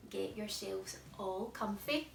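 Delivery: usual speaking voice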